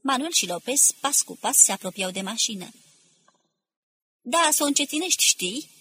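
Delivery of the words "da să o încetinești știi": raised-pitch call from the crowd; a mildly shouted retort. A narrator's reading. A voice in the crowd answers, calling out. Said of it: "strigă o voce".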